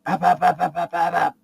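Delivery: high, rapid-fire popping; machine-gun the pops in a high voice; imitated gunfire. Player's character aiming down at hiding guard, imitated gunfire.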